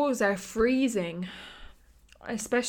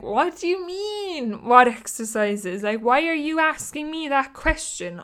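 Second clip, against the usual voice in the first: incredulous retort; 6 semitones higher, 6 dB louder than usual. Retold or imitated. Retorting to the doctor's question.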